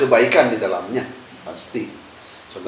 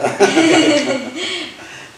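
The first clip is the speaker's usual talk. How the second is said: loud, high burst of real laughter; big amused belly laugh; hearty laugh; 7 dB louder than usual. Real, not acted. Joke about prisoners being freed.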